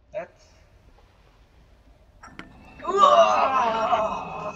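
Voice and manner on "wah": long, loud, high wail of fright; scream, then let it fall away; frightened scream. Real, not acted. Horror game player caught by a scare, screaming.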